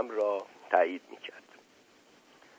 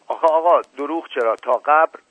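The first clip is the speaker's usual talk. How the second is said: louder, higher, pleading insistence; insistent protest; 6 semitones higher, 5 dB louder than usual. Acted. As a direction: swear to it loudly, protesting innocence. Servant insisting to angry master. (اصرار بلند و معترضانه)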